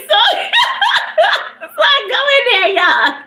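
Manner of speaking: high, fast words through laughter; laughing while talking. Real, not acted. Recounting the party, cracking up. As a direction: push the line out mid-laugh.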